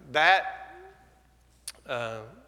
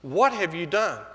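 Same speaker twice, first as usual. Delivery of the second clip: a mild mock yell, a bit louder; mock scream; 2 semitones higher, 3 dB louder than usual. Wife shouting at husband at dawn, mild mock yell. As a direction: call it out like his wife did. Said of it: "Top of her voice".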